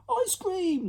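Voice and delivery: high squeal of delight; children squealing for treats; excited cry. Acted. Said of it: "they cried".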